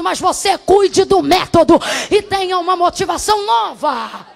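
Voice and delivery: loud, impassioned preaching, often shouting; full-force preaching. Acted. Pastor preaching to the congregation, loud and impassioned.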